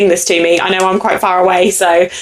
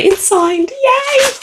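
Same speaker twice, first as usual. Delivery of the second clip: high, bright burst of joy at a signed copy; signed book, thrilled high squeal; delighted squeal; much slower than usual. Real, not acted.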